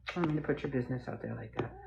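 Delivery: soft, fast murmur meant for the dog alone; hushed apology to dog. Real, not acted. Apologising to her dog for oversharing, hushed and quick. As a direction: lean in and quietly say sorry to the pup.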